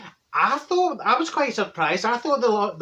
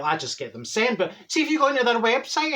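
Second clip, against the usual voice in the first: ordinary talk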